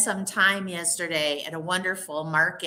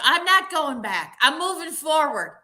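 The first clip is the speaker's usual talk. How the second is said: defiant; 7 semitones higher, 3 dB louder than usual. Shown as a demonstration. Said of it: "and you think".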